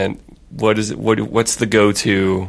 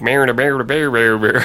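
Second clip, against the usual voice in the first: mock Southern drawl. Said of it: "tendency to be like".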